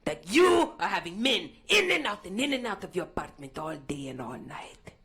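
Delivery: a scolding landlord on the phone. Retold or imitated. Landlord voiced in a stand-up bit.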